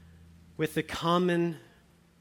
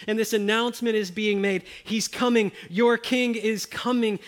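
louder, higher proclaiming climax; fervent preaching; 6 semitones higher, 5 dB louder than usual. Acted. Preacher announcing the King to the church, loud fervent proclaiming. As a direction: proclaim it like a herald.

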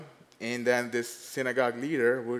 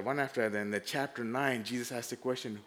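ordinary talk, nothing marked

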